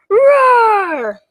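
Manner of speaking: loud, high, long dragon roar; rolled play roar, falling away; monster-scaring roar. Pretend. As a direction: roar big to scare the monster. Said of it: "so we can all roar".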